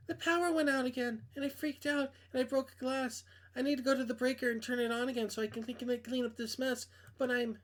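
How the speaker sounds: a hint of a nervous young woman; girl voice. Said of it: "I can't do girl voices".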